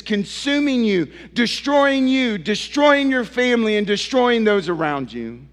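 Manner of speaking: urgent sermon plea, pushing on each repeat; fervent preaching. Acted. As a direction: press the question home to them. Preacher presses the self-righteous.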